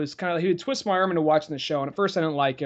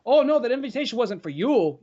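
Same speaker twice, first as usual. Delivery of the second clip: higher mock kid voice, R's lingering; imitating Jeremy's speech; 7 semitones higher than usual. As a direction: play the snooty kid who drags his R's, a bit higher. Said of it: "a lingering R when he would talk".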